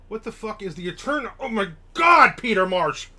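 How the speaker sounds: getting excited. Real, not acted. Recognizing an actor from the show, with real excited shouting. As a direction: blurt out the name in sudden delight. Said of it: "Sorry, I'm getting all excited".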